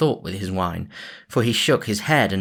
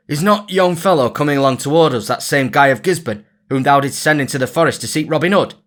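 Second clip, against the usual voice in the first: louder, higher urgent call; man warning the sheriff, loud; cried out; 6 semitones higher, 6 dB louder, faster than usual. A narrator's reading. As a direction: alert the sheriff eagerly. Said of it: "cried he".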